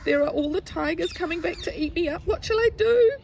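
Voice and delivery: high, loud storybook alarm; rising into a high cry of helpless worry; panicked little boy. Acted. Little boy seeing tigers return, high-pitched panic.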